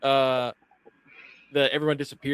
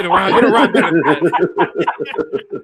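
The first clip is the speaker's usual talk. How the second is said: laughed through, slow and louder; laughing; 3 semitones higher, 8 dB louder, slower than usual. Real, not acted. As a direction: repeat it while cracking up. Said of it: "laughing repeat".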